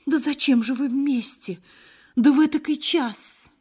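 high, feeble wail of an anxious mother; high-pitched, helpless lament; helpless dismay. A narrator's reading. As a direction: gasp it out high, hopelessly distressed. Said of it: "слабо ойкнув, всплеснула безнадёжно руками".

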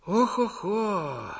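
put-on slow old man's groaning sigh; weary sigh. A narrator's reading. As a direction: sigh a drawn-out okh-ho-ho. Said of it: "вздохнул кто-то из стариков".

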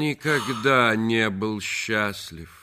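loud, slow, drawn-out denial; emphatic retort. Acted. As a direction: cut in forcefully over the plea. Husband cuts in on wife's plea. (громко, с нажимом возражая)

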